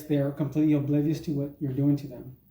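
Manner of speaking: ordinary talk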